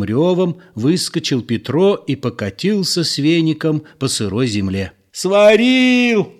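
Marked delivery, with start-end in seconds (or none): 5.1-6.3 very high, loud scream; screaming in pain. A narrator's reading. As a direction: howl it out, scalded. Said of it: "кричал Петро".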